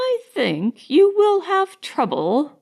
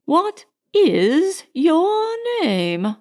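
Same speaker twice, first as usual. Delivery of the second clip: high, very slow, imperious demand; severe; 8 semitones higher, much slower than usual. A narrator's reading. Elder lady cutting off the boy, severe. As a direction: cut him off, leaning on each word. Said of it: "the severity of her manner was unmistakable".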